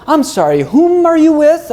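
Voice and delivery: snooty English put-on, high and drawn out; mock English accent. Rival agent looking down at him, mock English accent. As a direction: imitate a haughty agent with an English affectation. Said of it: "did you get a fucking English accent".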